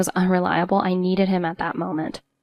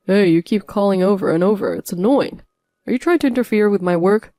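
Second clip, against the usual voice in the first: louder, annoyed complaint; irritated husband; 5 dB louder than usual. A narrator's reading. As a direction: snap at her for calling. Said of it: "husband irritated on phone".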